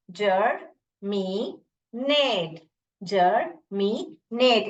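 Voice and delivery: जर, मी, नेट as three separate beats; syllable by syllable. Shown as a demonstration. Teacher sounds out the word for pupils, separated जर, मी, नेट. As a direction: pause between जर and मी and नेट. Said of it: "इसको ऐसे भी पढ़ सकते हैं".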